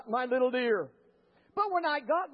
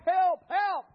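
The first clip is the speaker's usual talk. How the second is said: higher, mildly acted call for help; retold cry for help; 4 semitones higher, 4 dB louder than usual. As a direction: call out for help, half in earnest. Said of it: "I'm still crying".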